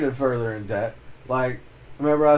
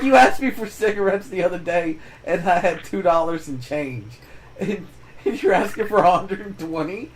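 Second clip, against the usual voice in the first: higher, louder exasperated reasoning; mock indignation at the jump in the ask; indignant retort; 5 semitones higher, 4 dB louder than usual. Reminding his sister of the cigarette money, indignant.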